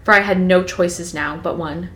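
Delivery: steady storytelling read; reading Twilight aloud to a co-host; novel read aloud. Acted. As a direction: read it like an audiobook chapter.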